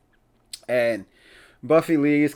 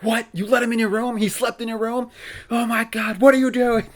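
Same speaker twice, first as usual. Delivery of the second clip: a friend panicking over the news; freaking out; 9 semitones higher than usual. Retold or imitated. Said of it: "Like, freaking out".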